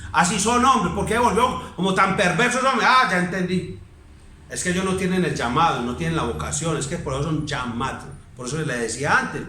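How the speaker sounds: ordinary delivery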